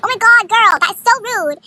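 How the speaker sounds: loud, high scolding; hammed-up scandalized reproach; mock outrage. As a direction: imitate an appalled crowd telling her off. Crowd's acted scolding.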